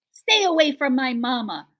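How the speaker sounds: loud, high protective yell; gosling yelling at the bears to protect Roz; gosling's cry. A narrator's reading. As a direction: shout to shield his mother. Gosling defends mother.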